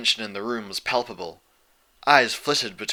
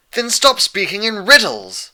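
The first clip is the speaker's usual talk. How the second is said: high, loud, slow angry outburst; angry cry; 11 semitones higher, 11 dB louder than usual. A narrator's reading. The Pegasus crying out at Celestia, exasperated. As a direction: yell it at the princess. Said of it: "the Pegasus cried".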